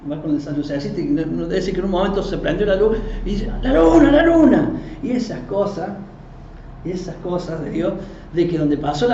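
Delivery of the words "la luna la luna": loud, high cry of discovery; retold excited shout. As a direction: call it out to the crowd, imitating. Retold sighting of the moon.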